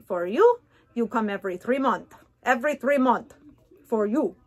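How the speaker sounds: imitated Eastern European-sounding accent; Marina's accent. Patient mimicking her hygienist for viewers, imitated foreign accent. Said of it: "That was her accent. That's how she talks".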